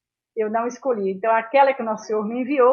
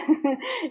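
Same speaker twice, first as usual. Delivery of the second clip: soft laugh; 6 semitones higher, 7 dB quieter than usual. Real, not acted. Laughs after island remark.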